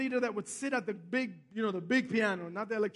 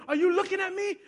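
raised pitch, pointed question; confrontational challenge; 6 semitones higher, 4 dB louder, faster than usual. Retold or imitated. Little pastor to a big guy on a train, confrontational challenge. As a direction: imitate someone calling out a stranger. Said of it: "he talks to a big guy like Adam".

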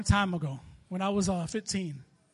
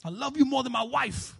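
mock declaration; 3 semitones higher than usual. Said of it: "I couldn't say that".

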